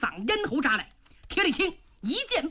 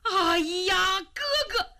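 high wailing cry for his brother; anguished cry over a slain brother; grief-stricken cry; 4 semitones higher than usual. Acted. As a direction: wail out the brother's name in anguish. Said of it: "疼得乱蹦".

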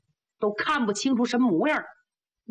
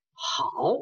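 plain reading voice

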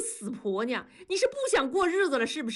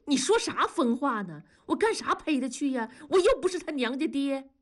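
higher-pitched defensive denial; cornered protest; 6 semitones higher than usual. A narrator's reading. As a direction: deny it, backed into a corner. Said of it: "已经退到墙根儿了". (被逼到墙角地辩白)

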